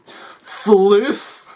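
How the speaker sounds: long drawn-out mock roar; thunderous anger. A narrator's reading. Doctor summoning the floof, hammed-up anger. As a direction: call it out like rolling thunder. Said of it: "he spoke like thunder too".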